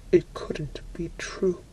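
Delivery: ordinary delivery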